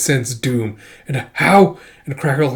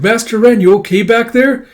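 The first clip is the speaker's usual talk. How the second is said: high concerned voice; 9 semitones higher, 7 dB louder than usual. Acted. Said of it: "It's like".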